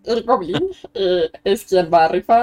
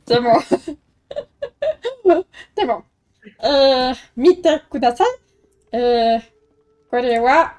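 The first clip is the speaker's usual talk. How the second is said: goblin; slower than usual. Acted. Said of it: "está hablando en Goblin".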